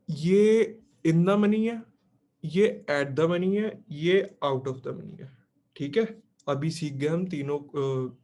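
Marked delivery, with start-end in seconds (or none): 0.1-5.4 slow, loud teacher voice pointing at each; emphatic slow classroom labelling. Shown as a demonstration. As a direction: spell out each label slowly, leaning on ये. Teacher marking three strikes on screen.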